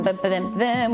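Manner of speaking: usual speaking voice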